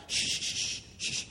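whispered shh, shh to hush her away; mock shushing. Disciples hushing the woman away, whispered shushing. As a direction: hush her off like a crowd would. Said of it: "begin to scoot the woman out".

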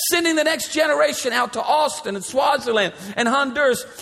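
high, ringing preacher's build to the crowd; full-force preaching. Acted. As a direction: preach it out, rising and driving.

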